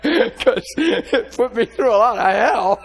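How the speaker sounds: high, loud laughing delivery; laughing while talking. Real, not acted. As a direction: push the words out through real laughter. Laughing while telling his story.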